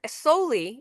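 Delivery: ordinary talk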